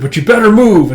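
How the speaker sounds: loud, high shouted order; acted action-hero shout; urgent warning. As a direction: warn them of danger coming fast. Colonel warns as robot army nears.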